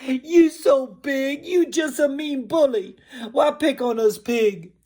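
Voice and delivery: high, loud wailing little pig; high loud hurt pig protest; crying out pig. Acted. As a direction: cry out at the big bully. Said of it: "and he cry".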